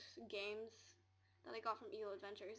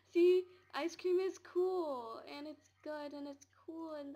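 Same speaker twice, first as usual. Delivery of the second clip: loud, high, drawn-out gushing; silly insistence; 5 semitones higher, 13 dB louder, slower than usual. Pretend. Telling viewers ice cream is cool, loud silly insistence. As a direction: belt it out playfully, stretching the words.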